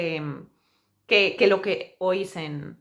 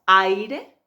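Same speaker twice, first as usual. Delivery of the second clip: aire loud, stretched, rising at the end; exaggerated aire; 7 dB louder than usual. Shown as a demonstration. Teacher exaggerating aire for learners. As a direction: overdo aire so every vowel rings. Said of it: "fijaos qué exagerado".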